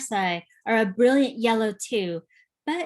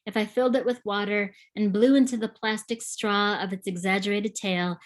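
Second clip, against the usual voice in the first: usual speaking voice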